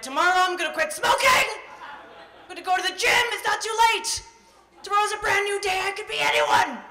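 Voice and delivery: very high, loud, shouted frenzy; desperate resolutions. Retold or imitated. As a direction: hysterical self-promises. Frantic mid-sex resolutions.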